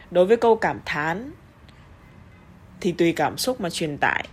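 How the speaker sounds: ordinary talk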